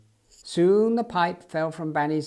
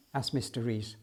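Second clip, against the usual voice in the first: plain reading voice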